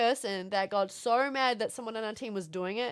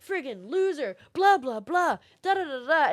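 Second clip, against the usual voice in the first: mock angry taunt; 5 semitones higher, 7 dB louder than usual. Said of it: "a couple of people on the other team".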